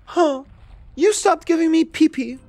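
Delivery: high, whiny mock voice; hammed-up high-pitched pouting complaint; Ellie. Player mocks Ellie complaining to Isaac, high pouty voice. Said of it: "Ellie's really like".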